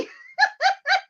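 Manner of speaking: very high, very loud shriek of laughter. Real, not acted. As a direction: let a squealing laugh burst out. Laughing while reading comments.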